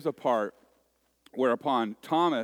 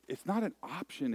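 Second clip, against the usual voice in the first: normal talking voice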